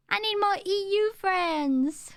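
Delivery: whiny plea. Real, not acted.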